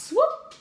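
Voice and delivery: high swoop sound; swoop sound effect. A narrator's reading.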